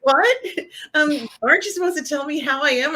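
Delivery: high-pitched mock bewilderment; shocked. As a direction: imitate a stunned woman protesting. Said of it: "she's all shocked".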